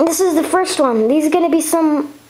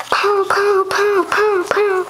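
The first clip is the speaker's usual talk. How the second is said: blaster sounds; 5 semitones higher, slower than usual. Pretend. Toy blaster fire sound effects.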